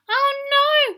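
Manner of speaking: high, loud alarmed cry; cried out. A narrator's reading. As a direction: squeal it in alarm. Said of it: "cried the princess".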